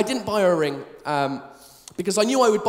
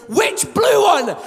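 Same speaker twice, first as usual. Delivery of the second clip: louder, slower, higher exasperated which blue one; exasperated shout; 7 semitones higher, 5 dB louder, slower than usual. Retold or imitated. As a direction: throw the question back at her, fed up. Exasperated question to wife.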